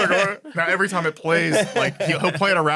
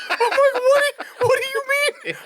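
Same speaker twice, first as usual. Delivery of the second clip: high, squeaky through laughter; can't get the words out for laughing; baffled laughing question; laughing disbelief. Real, not acted.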